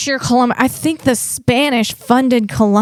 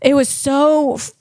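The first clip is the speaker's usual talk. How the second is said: ordinary talk, nothing marked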